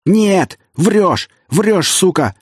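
high, loud enraged yell. Acted. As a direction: scream denial at him. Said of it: "орал князь".

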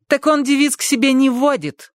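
a bossy housekeeper demanding entry; angry maid shouting. A narrator's reading.

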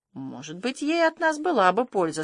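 ordinary delivery

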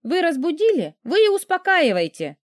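high-pitched, huffy retort; spiteful young woman's comeback; bratty grumble. A narrator's reading. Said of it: "вредно буркнула курочка".

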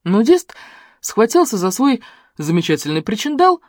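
ordinary delivery, nothing marked

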